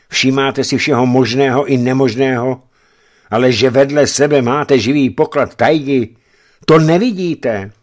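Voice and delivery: loud, high-ending scolding; emphatic reproach. A narrator's reading. Friend chiding a geologist blind to love, emphatic reproach. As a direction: drive it home loudly, exasperated. Friend chides him for not noticing.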